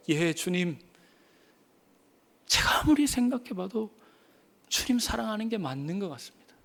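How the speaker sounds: soft, hesitant, sincere confession; humble answer. Acted. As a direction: answer softly, a bit shy. (조용하고 수줍게 진심으로)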